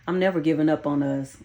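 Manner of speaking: ordinary delivery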